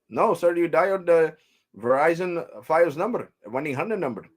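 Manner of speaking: put-on high Indian call-centre accent; imitated Indian agent. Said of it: "I'm talking like he was talking to me".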